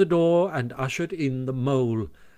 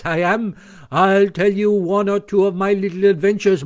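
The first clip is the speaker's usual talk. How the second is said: false modesty building to high, loud bragging; puffed-up boasting; 6 semitones higher, 5 dB louder than usual. A narrator's reading. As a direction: swell with pride as the list grows. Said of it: "Toad was puffing and swelling already".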